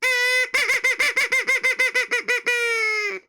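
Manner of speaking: loud high burst of laughter. Real, not acted. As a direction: crack up hard. After the bird bit.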